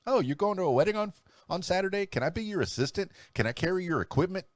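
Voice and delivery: high, fast mock-eager questions; eager would-be helper. Hopeful helper begging the DJ to assist, mock eager. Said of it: "I've been asked that so many times".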